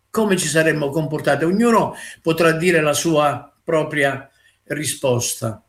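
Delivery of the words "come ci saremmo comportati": fast, tossed-off repeat of the question; a brisk follow-up question; quick repeat. Shown as a demonstration. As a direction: rattle it off briskly. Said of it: "Come noi ci saremmo comportati".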